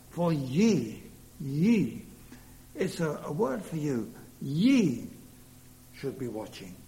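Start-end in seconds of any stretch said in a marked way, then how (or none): 0.1-2.0 "ye" punched louder, lingered on each time; stress on ye. Shown as a demonstration. Preacher pressing the word "ye" on his hearers, emphatic stress. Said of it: "I like the emphasis on the word ye". 4.4-5.1 "Ye" hit hard and loud, standing alone; stress on ye. Shown as a demonstration. Preacher telling listeners the word is for them, stressed "Ye". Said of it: "I like the emphasis on the word ye".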